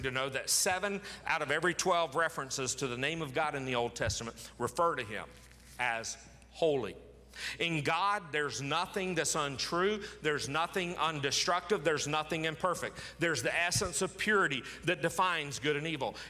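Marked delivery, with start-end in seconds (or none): none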